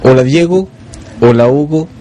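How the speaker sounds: normal talking voice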